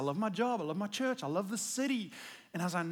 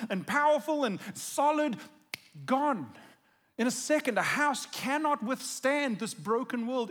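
louder, driving preacher's delivery; full-force preaching; 3 semitones higher, 6 dB louder than usual. Acted. Pastor preaching hard to the church, loud and driving.

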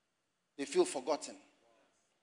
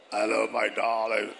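much lower, crooning "Hello, my darling"; deep-voiced suitor; 9 semitones lower, 3 dB louder than usual. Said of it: "come with a deep voice".

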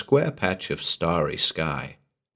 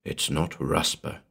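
low, hushed reply; soft voice; 4 semitones lower, 7 dB quieter than usual. A narrator's reading. Stranger above answering the sleeper, soft voice. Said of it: "said the soft voice".

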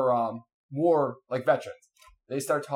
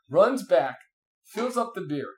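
ordinary talk

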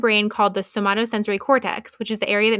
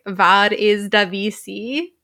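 put-on slow, loud Dutch-accented question; exaggerated Dutch accent; 5 dB louder, much slower than usual. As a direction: draw it out in a mock Dutch accent. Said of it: "were actually all just speaking accented English".

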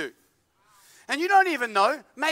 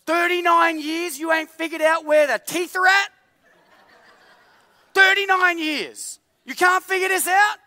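higher, louder exasperated scolding, laughing through it; mock outrage; 7 semitones higher, 4 dB louder than usual. The preacher yells at his own tongue after biting it, laughing. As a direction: scold your own body part in disbelief. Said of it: "I'm like".

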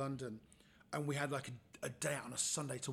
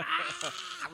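sharp, piercing high squeal, louder; 8 dB louder than usual. Retold or imitated. Imitating the colleague's shock, a high loud squeal. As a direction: yelp like a shocked man. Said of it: "he squealed like".

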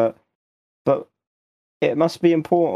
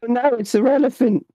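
jumpy, higher-pitched alarm; 7 semitones higher than usual. Acted. Jumpy character mistaking floating shape for an elephant, mild alarm. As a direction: blurt it higher, mildly startled. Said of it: "I'm a bit jumpy".

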